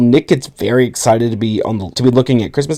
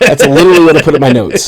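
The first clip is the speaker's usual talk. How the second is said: said through real laughter, a little louder; laughing; 3 semitones higher, 5 dB louder, faster than usual. Real, not acted. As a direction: laugh while getting the words out. Both laughing at the ritual note.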